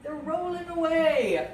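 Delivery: high, slow cry of dismay; oh no, something's falling; alarmed. Real, not acted. Cookies rolling off the tray.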